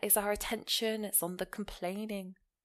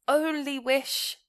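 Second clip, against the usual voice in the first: "only wish" louder and slow, both words punched; emphasis on only wish; 5 semitones higher, 7 dB louder, slower than usual. Shown as a demonstration. Teacher stresses the key words to show emphasis, loud and slow. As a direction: hit "only wish" hard and slowly. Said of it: "So a lot of emphasis here".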